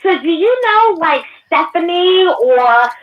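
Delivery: high, drawn-out gushing stranger; put-on camper voice. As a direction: imitate an over-eager camper, stretching the words. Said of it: "Where is this new voice coming from? That don't sound like you".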